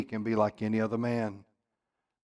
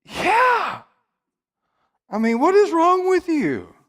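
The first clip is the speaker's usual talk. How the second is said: incredulous; 6 dB louder, slower than usual. Retold or imitated. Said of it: "you scratch your head and you're like".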